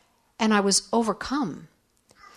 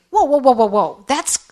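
loud, higher-pitched burst of startled whoas; mock alarm; 5 semitones higher, 6 dB louder than usual. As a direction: imitate people stopping her in shock. People react shocked to her story.

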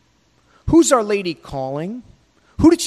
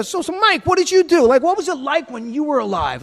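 much higher, eager curious asking; eager questioning; 8 semitones higher than usual. Pretend. Prophets in heaven pressing Mike about his life, much higher and eager. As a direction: lean in, dying to hear the story.

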